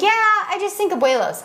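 a hint of a noncommittal husband; imitating husband. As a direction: lightly mimic a husband hedging his preference. Retelling husband's restaurant pushback.

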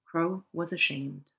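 usual speaking voice

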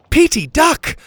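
loud, high urgent call; shouted warning. A narrator's reading. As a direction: yell a warning to a friend. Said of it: "Pockets shouted".

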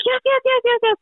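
rapid-fire, high-pitched string of yeses; retold eager acceptance. Bride retelling how she accepted the proposal, eager.

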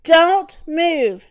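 high, loud warning shout. Acted. Husband warning his family in the sinking bed, loud shout. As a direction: bark the order to freeze. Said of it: "shouted Mister Watson".

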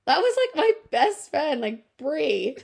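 high, sing-song innocent lie; fake innocent. Lying to her jealous date about the caller, high and innocent. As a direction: lie brightly, pitch way up. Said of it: "I was like".

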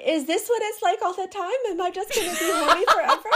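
high-pitched comic bewilderment; mock panic. Imagining a first time channeling, mock panic.

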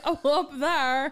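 slow, drawn-out Irish accent. Shown as a demonstration. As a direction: stretch out the Irish accent on purpose. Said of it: "my Irish accent's coming out".

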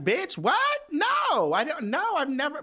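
high-pitched, loud shocked reaction; shocked disbelief. Retold or imitated. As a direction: squeal it in total disbelief. Retold shock at doctor's question.

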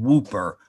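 WHOOPER with an "oo" in the first syllable; father's botched "Whooper" for Whopper; mispronounced Whopper. Retold or imitated. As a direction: say Whooper, rhyming with trooper. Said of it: "he meant to say Whopper".

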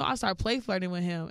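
ordinary talk

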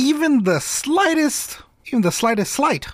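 slightest drawn out high and slow; stretched emphasis. Acted. Stressing how little it took, slow and high.

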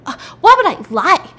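high, loud acted bafflement; incredulous protest. Husband denying accusation to police, high incredulous protest.

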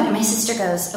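plain reading voice

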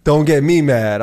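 warning the friend at the wedding, loud and firm; retold warning. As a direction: lay down the law slowly. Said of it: "I was like".

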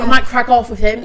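loud, hammed-up British islander; put-on British villa slang; mock British accent. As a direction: declare it as a British dating-show contestant. Acting British Love Island contestants.

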